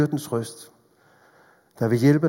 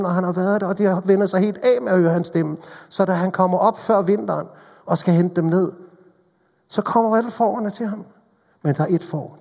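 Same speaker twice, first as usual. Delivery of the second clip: raised, louder preaching voice, driving the story on; full-force preaching; 6 semitones higher, 6 dB louder than usual. Acted. Preacher telling the congregation of the lost sheep, in full-force preaching. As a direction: preach it out with growing urgency.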